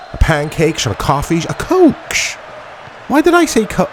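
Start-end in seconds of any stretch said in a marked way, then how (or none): 1.5-3.9 "coach" shot way up high in disbelief; high-pitched mock disbelief at his own slip; baffled at himself. Real, not acted. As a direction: question your own silly word, amused. Baffled by own impression word.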